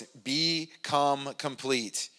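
BECOME COMPLETE, each part drawn out slowly; slow, weighty stress on "become complete"; drawn-out key phrase. Shown as a demonstration. Repeats key phrase of the reading.

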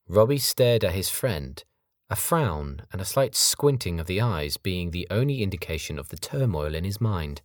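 plain reading voice

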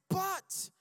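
But, high and loud; exclaimed but. Real, not acted. Preacher proclaiming God's but to the congregation.

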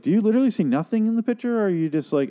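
high, fast, exasperated disbelief; recounted incredulous outburst; incredulous retort. Retold or imitated. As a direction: question it in baffled disbelief, pitch way up.

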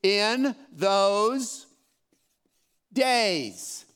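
"In those days" drawn out, with a long pause before "days"; stressed phrase. Shown as a demonstration. Pastor quoting the verse slowly to raise a question. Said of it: "that would cause some questions".

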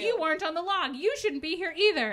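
imitating a heated confrontation; indignant retort. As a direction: fire back at an intruder. Retold confrontation, she snaps back.

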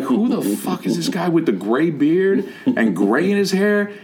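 mock bafflement. Onlooking students wondering who the stranger is, high mock disbelief.

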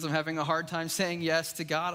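ordinary talk

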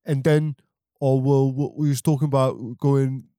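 broad, hammed-up English guy; exaggerated English accent; mock English accent. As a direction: ramble in a comic British accent. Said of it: "the guy who talks like this".

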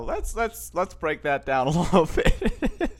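words slipping out through real laughter, slowly; trying to hold in a laugh and failing. Real, not acted. Podcaster reacting to a comedy clip, laughing through his words. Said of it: "I was trying not to laugh into the microphone".

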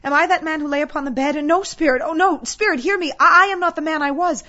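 loud, high, anguished begging; desperate pleading. Acted. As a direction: cry out for mercy in mounting terror, then implore. Scrooge begs at his grave.

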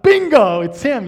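excited. Retold or imitated. Thrilled to spot the doctor.

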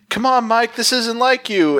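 hammed-up plea to a friend gone bad; mock pleading. Character pleading, retold.

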